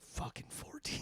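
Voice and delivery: breathless whisper of realization. Pretend. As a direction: whisper it to yourself. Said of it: "You're gonna be like".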